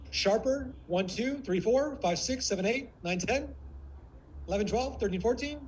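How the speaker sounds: high-pitched, sharp counting in short bursts; sharp warrior. Shown as a demonstration. Coach demonstrates the warrior range, sharp. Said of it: "sharp like this".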